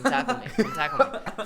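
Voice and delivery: high laughing dare. Retold or imitated. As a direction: laugh through the taunt. Daring him, laughing.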